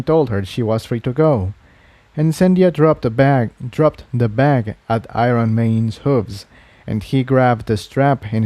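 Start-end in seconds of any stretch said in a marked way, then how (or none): none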